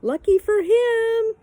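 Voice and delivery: high, loud, stretched shout; yelling after. A narrator's reading. Pippi calls after the girl, shouting. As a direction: holler it down the road.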